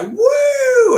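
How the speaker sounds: high, loud whoop; mock the paid audience whooping; paid crowd cheer. Mimicking the paid crowd cheering for Bloomberg, loud high whoop. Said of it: "they would just be like".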